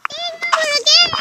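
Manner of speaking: high, loud groan of defeat; exasperated gamer cry; frustrated shout. Real, not acted. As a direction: yell it out in frustration. Losing the game.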